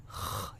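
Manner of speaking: خ as a short rasp; kha friction. Shown as a demonstration. Teacher isolating the kha sound. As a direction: scrape a quick خ. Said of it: "یہ والا sound".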